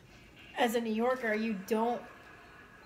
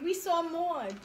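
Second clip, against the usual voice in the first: higher-pitched New York mother impression; Doug's mom; 5 semitones higher than usual. Retelling a King of Queens episode, high New York mom impression. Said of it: "in comes Doug's mom. She's like".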